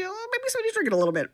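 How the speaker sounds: high, fast, teasing aside; sly insinuation. Pretend. Host hinting the ghost drains power, high sly tease. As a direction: wink at the audience about the ghost.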